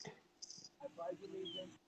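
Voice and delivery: soft, slow whispered pledge; hushed pledge. Acted. Host starting the pledge of allegiance in a slow hush. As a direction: murmur the pledge solemnly, slowly.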